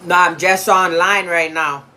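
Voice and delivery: shouting to another room; yelling across the house. Real, not acted. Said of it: "I can't keep yelling across the house".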